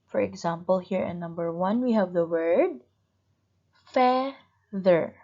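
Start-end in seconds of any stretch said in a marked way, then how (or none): 3.9-5.1 "feather" as fea-ther with a clear pause between; syllable by syllable. Shown as a demonstration. Teacher marks syllables in number one, "feather" split in two. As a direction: cut "feather" into two strong beats. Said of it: "that's why it's two syllables".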